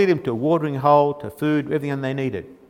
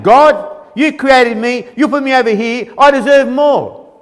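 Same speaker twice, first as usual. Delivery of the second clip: entitled whine; 4 semitones higher, 5 dB louder than usual. Retold or imitated. Preacher mimics entitled mankind.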